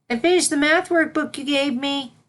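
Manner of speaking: high, eager little pupil; proud schoolgirl showing off her work; young filly voice. Acted. Young filly speaking to mentor.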